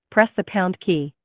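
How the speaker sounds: normal talking voice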